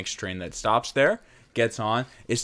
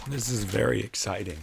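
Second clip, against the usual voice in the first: [name] voice; 6 dB quieter than usual. Acted. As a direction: murmur it like a brooding streamer. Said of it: "It's a corpse husband voice".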